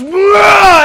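loud, high mock monster blah; monster roar. Preacher playing Satan scaring everyone, mock roar. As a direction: bellow like a cartoon beast. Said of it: "He goes".

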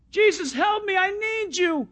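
high, pleading call; cry for help. Acted. Beggar calling after Jesus for help, pleading cry. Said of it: "his first cry was a cry for help".

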